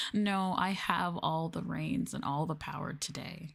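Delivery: usual speaking voice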